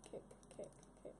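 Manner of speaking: under-breath whisper; whispered kicks. Real, not acted. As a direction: whisper each kick.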